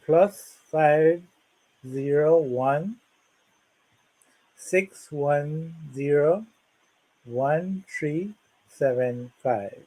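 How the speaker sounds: slow, deliberate digit-by-digit dictation; over enunciating. Shown as a demonstration. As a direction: read the phone number out carefully. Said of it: "let me say that slowly".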